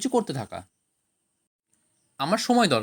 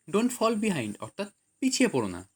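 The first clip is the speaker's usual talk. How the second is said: usual speaking voice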